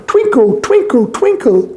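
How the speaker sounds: slow, then high sing-song twinkle; playful high twinkle; twinkly call. A narrator's reading. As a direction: make the stars twinkle with your voice. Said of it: "He shouted".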